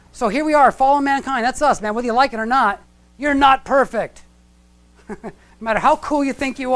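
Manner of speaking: loud, high sermon emphasis; preaching. Acted. Preacher telling congregation they're fallen, full-force preaching. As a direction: hammer the point home to the congregation.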